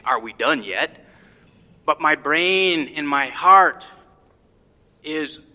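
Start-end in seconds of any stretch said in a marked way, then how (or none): 0.0-1.1 lower, weary voice of an aching body; lightly voiced exhausted body asking to stop; tired body voice. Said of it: "my body's saying".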